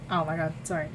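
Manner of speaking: ordinary delivery, nothing marked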